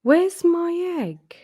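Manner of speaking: high, slow and plaintive cry; a lonely little rabbit calling out, slowly; forlorn plea. Acted. Peter alone under the table.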